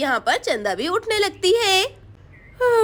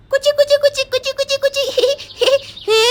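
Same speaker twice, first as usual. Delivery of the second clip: tickling coo; 7 semitones higher, faster than usual. Acted. Boy tickles the sleeping girls, giggling. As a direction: tease them awake with a sing-song tickle.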